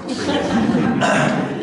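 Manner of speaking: laughing through the words, with an airy breathy giggle; sheepish laughing aside. Real, not acted. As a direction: chuckle it out as an aside. Laughing aside about the show.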